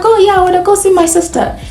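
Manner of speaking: ordinary talk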